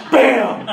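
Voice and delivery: loud sharp shotgun bang; shotgun bam. Retold or imitated. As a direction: fire off the word like a gunshot. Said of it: "then we make a shotgun sound".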